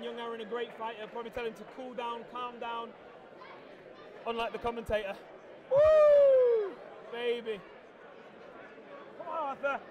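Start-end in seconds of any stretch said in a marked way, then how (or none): 5.7-6.7 high, loud whoop of joy; excited whoop. Real, not acted. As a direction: let out a big cheering whoop. Cheering during the fight.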